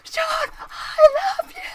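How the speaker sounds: high, slow shrieking declaration; screaming fangirl. Retold or imitated. Fan screaming at her hero, high and drawn out. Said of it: "I was like".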